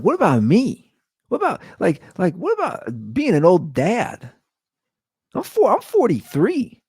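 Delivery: high, indignant mock pleading; mock protest. Husband to wife about being an old dad, high mock protest.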